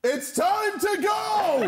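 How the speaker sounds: high, slow shouted exit line; shouting. Acted. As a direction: yell it out as you bolt. Fleeing the awkward date.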